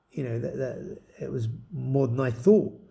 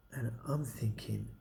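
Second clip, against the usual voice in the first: slow near-whisper; hushed aside; 3 semitones lower, 12 dB quieter, slower than usual. Real, not acted. Listening to the friend on the phone, whispering. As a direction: drop to a whisper mid-story.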